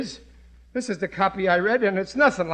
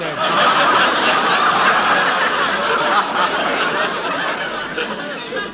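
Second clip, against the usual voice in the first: nervous laugh; 8 semitones higher, 5 dB louder than usual. Acted. Nervous slip in front of guests.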